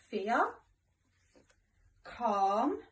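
ordinary delivery

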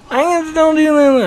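high-pitched silly put-on voice; speaker's old voice. Shown as a demonstration. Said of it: "mimic my old voice".

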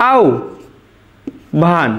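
আহ্বান said as আউ, then ভান, with a pause between; correct pronunciation, syllabified. Shown as a demonstration. Teacher showing students how আহ্বান is really said. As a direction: split it into আউ and ভান. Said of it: "এটাই আমাদের শুদ্ধ উচ্চারণ".

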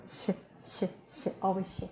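soft voiceless "sh"; whistled sh. Shown as a demonstration. Teacher praising learners' practice, models whistled "sh". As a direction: hiss "sh" lightly, no vowel after it. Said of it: "perfect Russian pronunciation with this sound".

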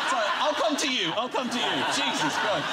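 laughing high as the wife; giggling apology through laughter; playing the wife, laughing as she answers. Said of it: "And she's like".

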